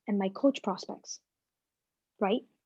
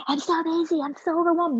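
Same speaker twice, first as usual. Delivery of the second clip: higher, whiny overwhelmed complaint; mock stressed busy person; 7 semitones higher than usual. Mocking her old self complaining she's busy, pitched-up whine. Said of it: "There's no more busy".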